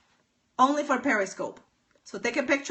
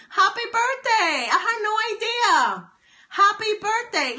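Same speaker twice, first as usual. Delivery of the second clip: excited birthday greeting; 9 semitones higher, 3 dB louder than usual. Real, not acted. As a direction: lift the voice high and stretch each word with joy. Birthday greeting to Cynthia live.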